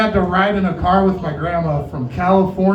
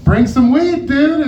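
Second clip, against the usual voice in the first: excited; 9 semitones higher, 5 dB louder, slower than usual. Retold or imitated. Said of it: "You're like".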